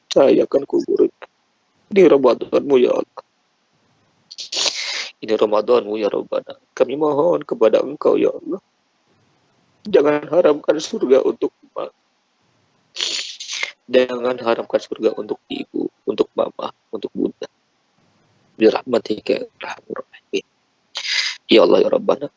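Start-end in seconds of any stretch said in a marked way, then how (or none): none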